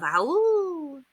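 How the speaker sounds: high howling cry; urgent howl. Acted. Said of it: "urgent howls of".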